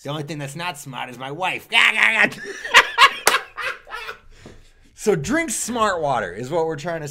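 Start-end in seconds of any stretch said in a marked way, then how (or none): 1.7-4.6 shrieking burst of laughter; loud high laugh. Real, not acted. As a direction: howl with laughter. Laughing at own joke.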